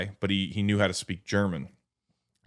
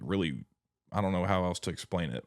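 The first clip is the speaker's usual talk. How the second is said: normal talking voice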